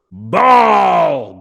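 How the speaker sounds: loud bellowed demand; bellowing. A narrator's reading. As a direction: bellow for the ball. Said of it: "bellowed McNabb".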